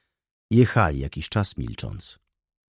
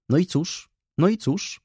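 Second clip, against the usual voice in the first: higher, insistent, pressing twice; impatient; 10 semitones higher than usual. A narrator's reading. Widow pressing Wokulski at the saddle, impatient. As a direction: urge him on, rising each time. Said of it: "pytała niecierpliwie".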